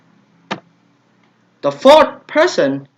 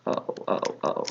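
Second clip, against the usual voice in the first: under-breath reaction; 12 dB quieter than usual. Real, not acted. Reacting under breath while solving.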